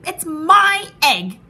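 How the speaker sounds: high, very loud, drawn-out claim; indignant bird's loud protest; sputtering outrage. A narrator's reading. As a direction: shout it slowly, insisting it is yours. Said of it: "she sputtered".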